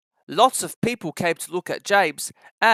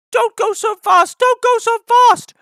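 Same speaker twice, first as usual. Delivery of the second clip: much higher, slow, louder grumble; grumbling coaches; 9 dB louder than usual. Acted. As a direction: complain like cross old coaches. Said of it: "grumbled the coaches".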